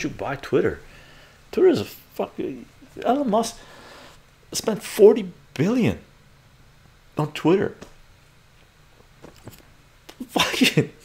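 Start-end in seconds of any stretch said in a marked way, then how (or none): 1.5-10.9 high-pitched, incredulous exasperation; real exasperation. Real, not acted. Streamer reacting to forty billion spent on Twitter, real exasperation. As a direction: sputter in disbelief, voice pitched way up.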